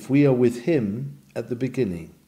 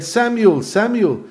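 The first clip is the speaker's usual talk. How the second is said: higher, louder call of God, each name falling; 9 semitones higher, 4 dB louder than usual. Retold or imitated. God calling young Samuel in the night, higher and louder. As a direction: call the boy's name twice from above. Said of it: "You remember he said".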